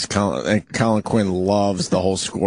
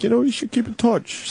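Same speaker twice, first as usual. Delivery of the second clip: much higher, mock-helpful advice voice; high-pitched self-quote; 12 semitones higher than usual. Host mimicking himself advising the intern, high-pitched self-quote. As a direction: pitch your own old advice way up.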